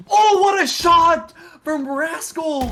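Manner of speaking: roaring the big play, high and loud; excited shout. Real, not acted. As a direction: yell it like the crowd just exploded. Caster erupts at big shot.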